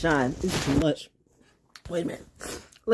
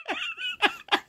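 high, softer trailing giggle; squeaky tail of a laughing fit; 5 dB quieter than usual. Real, not acted. Streamer can't stop laughing at misery, high giggle.

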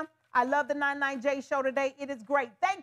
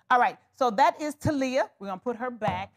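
normal talking voice

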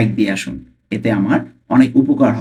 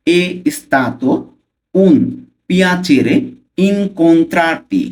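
very slow, raised pitch, a pause between words; slow pronunciation; 5 semitones higher, slower than usual. Shown as a demonstration. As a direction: sound out the phrase word by word for learners. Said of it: "slowly উচ্চারণ করবো ফার্স্টে". (ধীরে ধীরে, থেমে থেমে উচ্চারণ)

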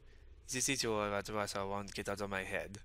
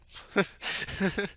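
high burst of laughter; laughing; 10 semitones higher, 4 dB louder than usual. Acted. Friend gets rebuffed, high amused laugh.

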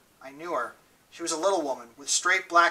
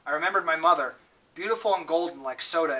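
usual speaking voice

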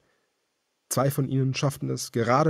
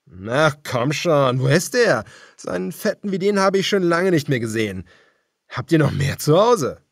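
higher, louder sneering voice; mocking cannibal; 6 semitones higher, 8 dB louder than usual. A narrator's reading. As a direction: gloat over the fat prey. Cannibal leader mocking Daniel.

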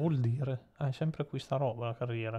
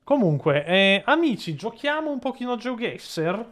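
upbeat host call; 7 semitones higher, 6 dB louder than usual. Real, not acted. Host kicks off the game.

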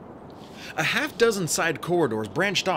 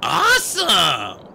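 much louder, slow, delighted; triumphant cheer; 5 semitones higher, 7 dB louder, much slower than usual. A narrator's reading. Grover cheers about camping in the living room, loud and delighted. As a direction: crow with glee. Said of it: "Grover grinned triumphantly".

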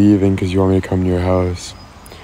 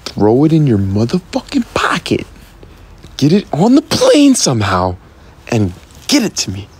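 straining, swooping high-pitched demand; exaggerated ultimatum breaking into squeaks; mock threat; 5 semitones higher than usual. Streamer orders Kate to get potato on plane, hammed-up menace.

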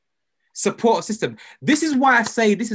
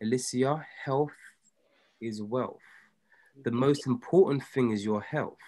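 soft, gentle reminder; tender; 5 semitones lower, 7 dB quieter than usual. Real, not acted. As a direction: say it gently, almost softly.

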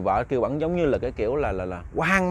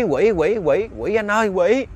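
high, louder cries of alarm; frightened cry; 6 semitones higher, 4 dB louder, slower than usual. Acted. Wife waking to a demon calls her husband, frightened cry. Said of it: "thì người tự la lên".

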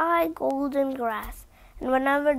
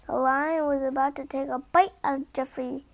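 ordinary delivery

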